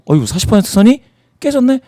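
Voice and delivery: lightly acted exclamation of surprise; imagined surprised reaction. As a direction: voice a viewer's mild astonishment at the news. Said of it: "라고 생각하실 수 있겠죠".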